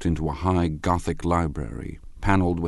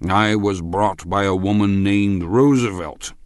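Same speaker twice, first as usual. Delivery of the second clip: slow, loud, raised tipsy drawl; drawn-out drunken explanation, pitched up; drunken man; 4 semitones higher, 6 dB louder than usual. A narrator's reading. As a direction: announce it ponderously like a drunk. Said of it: "sitting somewhat drunk".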